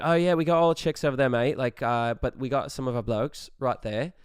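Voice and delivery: put-on Australian accent. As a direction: put on a fake Australian accent, blokes and all. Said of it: "excuse me, the accent".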